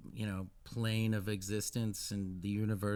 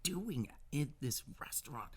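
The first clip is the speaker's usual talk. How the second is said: higher-pitched mock disbelief; acted child's bewilderment; 6 semitones higher, 4 dB quieter than usual. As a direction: play a kid baffled at seeing their teacher. Child startled to see teacher.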